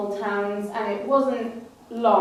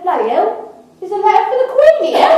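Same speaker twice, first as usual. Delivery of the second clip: much higher, louder, fast astonishment; surprised robber; 10 semitones higher, 9 dB louder, faster than usual. Acted. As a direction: blurt it out in shock. Robber finds the letter.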